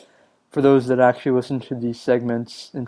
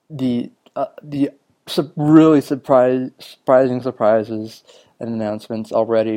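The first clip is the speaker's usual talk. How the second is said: ordinary talk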